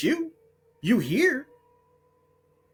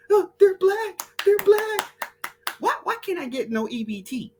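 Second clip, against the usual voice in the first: much higher, whining mock of a gullible fan; high whiny mock; 13 semitones higher than usual. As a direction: squeak it like a dupe. Mocking gullible people.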